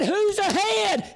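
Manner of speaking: very slow, high drawn-out mock shout; stretched-out hammed-up holler. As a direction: call the question across the field. Man shouts question across field.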